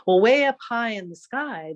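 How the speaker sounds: plain reading voice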